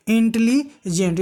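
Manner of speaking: "Intelligent" slowly, split into parts; broken up, drawn out. Shown as a demonstration. Teacher showing a word that takes longer to say, drawn out. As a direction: stretch "intelligent" out, breaking before the last part. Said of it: "थोड़ा समय खींच रहा है".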